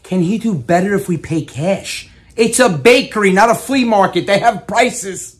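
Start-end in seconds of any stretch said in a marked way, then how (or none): 2.3-5.4 exasperated. Real, not acted. Nephew scolds aunt haggling.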